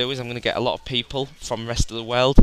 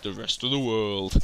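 put-on voice; 5 dB quieter than usual. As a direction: stretch it out in a mock showdown voice. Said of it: "I say it in that voice".

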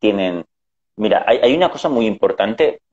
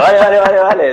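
responde alto y agudo, imitando el volumen del hermano; vale en voz alta; 10 semitones higher, 7 dB louder, faster than usual. Retold or imitated. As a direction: vale repetido a gritos, medio en broma. Responde al hermano que grita. (contestando a voces a su hermano)

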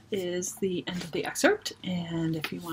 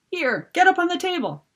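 urgent call; 11 semitones higher, 4 dB louder than usual. Acted. Pulling Mop to safety.

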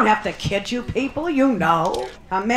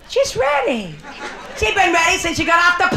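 eager squeal; 6 semitones higher than usual. Acted. Mock-eager emphatic line.

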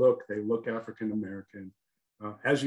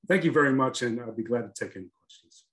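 usual speaking voice

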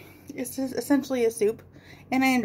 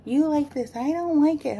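mimicking daughter; 6 semitones higher than usual. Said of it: "She's like".

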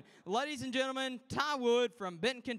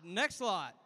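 normal talking voice

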